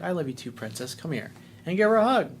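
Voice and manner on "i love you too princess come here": soft, tender dad voice; father answering his daughter's I love you, softly tender. Retold or imitated. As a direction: hush it lovingly to his girl. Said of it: "It's like".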